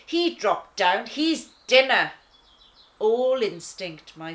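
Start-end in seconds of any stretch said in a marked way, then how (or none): 0.1-2.1 loud growling lioness voice; loud, hungry lioness insisting; fierce insistence. Acted. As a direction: bark it as a verdict. Sister lion, hungry, insists.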